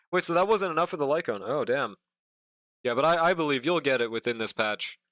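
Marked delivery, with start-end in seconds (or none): none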